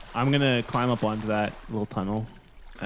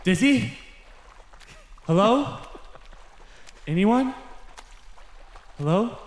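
calling out; 9 semitones higher, slower than usual. Acted. Calling out in dark pit.

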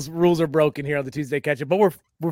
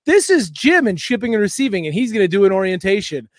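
corporate presenter; 5 semitones higher, 5 dB louder than usual. Retold or imitated. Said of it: "They're like".

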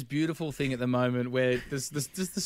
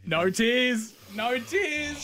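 excited; 9 semitones higher, much slower than usual. Real, not acted. Host checking co-host after story.

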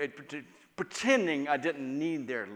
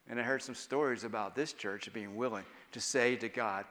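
ordinary talk, nothing marked

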